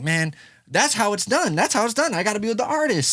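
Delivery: high, eager realization; inspired. Retold or imitated. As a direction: say it like a revelation. Realizing how studios work.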